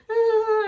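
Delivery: high little mock whimper; imitated child's whimper. Vlogger imitating her little self in meditation, high whimper. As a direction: whimper like a frightened little child, play-acted. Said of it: "she was so like".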